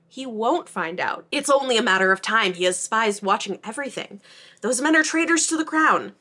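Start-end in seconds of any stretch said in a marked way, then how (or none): 4.6-6.2 louder, higher, heated; raised voice in a heated argument. Retold or imitated. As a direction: argue back hard.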